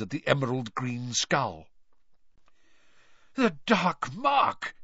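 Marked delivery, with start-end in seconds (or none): 3.3-4.7 slow, higher, out of breath; panting. A narrator's reading. Bagman, breathless, stares at the skull, panting. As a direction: gasp it out just after apparating. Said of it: "he panted".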